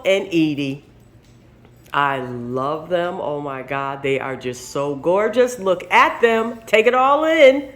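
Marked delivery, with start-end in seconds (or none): none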